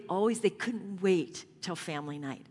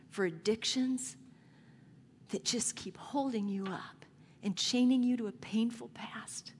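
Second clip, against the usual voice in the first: hushed, tender voice of Jesus in his suffering; Jesus speaking from the cross. Acted. Jesus on the cross speaking to the people, tender and whispered. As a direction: speak as Jesus, barely above a whisper, full of love.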